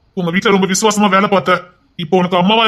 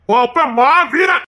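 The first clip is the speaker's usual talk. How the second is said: awed praise; 6 semitones higher, slower than usual. Acted. Awed praise of the hero father.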